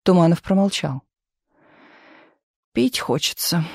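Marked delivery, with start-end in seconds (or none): 2.7-3.6 wistful complaint, a touch of misery. A narrator's reading. Misha complains to the men that he is thirsty, wistfully. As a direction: say it with weary longing. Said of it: "тоскливо сказал Миша".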